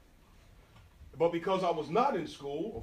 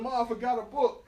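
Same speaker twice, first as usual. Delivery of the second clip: higher, laughing through a lame excuse; 7 semitones higher, 4 dB louder than usual. Retold or imitated. Son calling back to his mother, laughing through the excuse. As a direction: play innocent while cracking up. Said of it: "I said".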